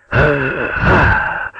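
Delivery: high, helpless "ah" moans; spent gasping groans. A narrator's reading. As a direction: gasp wordlessly, overwhelmed. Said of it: "только и мог уже издавать". (изнемогающие стоны)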